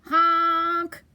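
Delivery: loud, held honk of alarm; scared truck honk. A narrator's reading. Stuck dump truck crying out, scared honk. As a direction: honk out for rescue. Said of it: "and he sounded scared".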